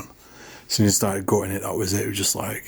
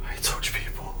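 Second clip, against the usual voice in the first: whispered; 11 dB quieter than usual. Real, not acted. Repeating spirit box word.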